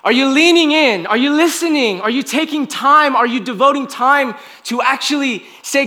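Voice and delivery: high, forceful preaching voice; impassioned preaching. Real, not acted. Preacher urges the congregation to lean in and listen to God, impassioned.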